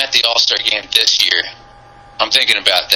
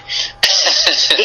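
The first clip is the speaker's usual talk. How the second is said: light breathy laugh; embarrassed laugh; 7 semitones higher than usual. Real, not acted. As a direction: laugh it off sheepishly. Laughs after cursing apology.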